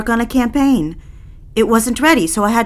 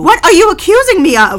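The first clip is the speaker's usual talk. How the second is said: high, loud shouted retort; full shout; furious shout; 9 semitones higher, 8 dB louder than usual. Acted. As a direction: daughter screaming at mother. Daughter shouts back.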